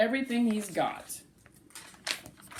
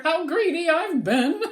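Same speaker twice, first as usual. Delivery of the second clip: higher, louder, laughing through it; laughing sputter; 7 semitones higher, 6 dB louder than usual. Acted. Bruce confessing his greed between giggles. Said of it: "proclaimed with a sputter".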